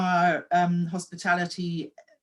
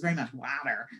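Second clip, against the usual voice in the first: Californian water; 5 semitones lower, 4 dB quieter than usual. Retold or imitated. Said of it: "strong Californian accent".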